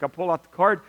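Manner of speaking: ordinary talk, nothing marked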